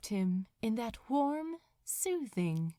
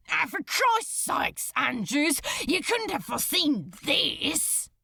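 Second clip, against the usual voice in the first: high, loud burst of exasperation; vehement outburst; 7 semitones higher, 5 dB louder than usual. Acted. Crowley lashes out at Andrews, vehement high loud outburst. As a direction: snap it out hard and sudden. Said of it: "exclaimed Crowley suddenly, with a vehemence".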